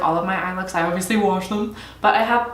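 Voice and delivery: usual speaking voice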